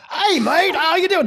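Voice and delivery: high, loud mock Australian accent. Retelling a chat with friend Glenn, loud high Australian accent. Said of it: "with his Australian accent".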